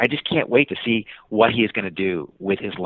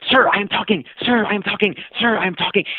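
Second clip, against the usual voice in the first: put-on high-pitched persistent kid voice; a light boyish nagging voice; imitating the kid; 11 semitones higher than usual. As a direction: pester like an eager boy on a scooter. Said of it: "As he scooted along the side of me".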